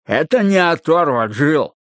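garbled, excited footballer voice; mumbling through mouthguard. A narrator's reading. Footballer objects to his skinny mate, mumbling excitedly. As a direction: push crumpled words past a mouthguard. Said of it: "слова выходили невнятными и скомканными".

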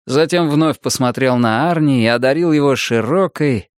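plain reading voice

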